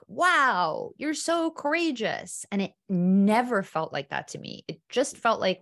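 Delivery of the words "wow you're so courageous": high, lightly imitated admiring friend; mock gushing friends. Friends praising her solo trips, high mock gush. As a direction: lift way up to mimic the friends' praise. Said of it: "my friends would always be like".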